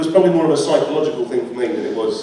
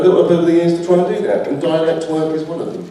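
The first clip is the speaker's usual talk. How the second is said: ordinary delivery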